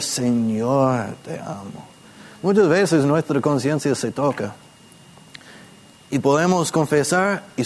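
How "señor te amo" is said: Señor leaned on, te amo falling away softer; weight on "Señor", then let "te amo" drop; stress on Señor. Shown as a demonstration. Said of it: "al enfatizar". (acento en "Señor", "te amo" más suave)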